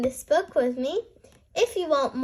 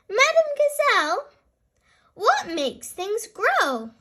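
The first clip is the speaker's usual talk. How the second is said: put-on high, bright little-girl piggy voice; a small girl asking eagerly; Peppa's voice; 8 semitones higher, 3 dB louder than usual. Peppa asks her teacher, high and eager. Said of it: "asks Peppa".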